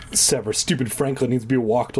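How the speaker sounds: ordinary delivery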